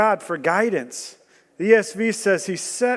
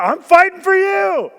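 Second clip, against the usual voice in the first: high, loud protest; mock indignant shout; 8 semitones higher, 5 dB louder than usual. People protesting to God, loud and indignant. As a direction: insist loudly, a touch offended. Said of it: "And we're like".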